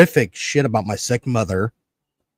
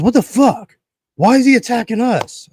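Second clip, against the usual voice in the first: high, pitched-up mock outrage; mimicking angry friend; 9 semitones higher than usual. Friend imitated, angry about the attack, pitched-up indignation. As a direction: play the annoyed friend, voice jumping up. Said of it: "getting pissed".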